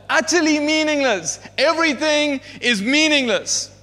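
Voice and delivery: a preacher crying out the words of Ecclesiastes; preaching at full force. Acted. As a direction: proclaim the emptiness from the pulpit.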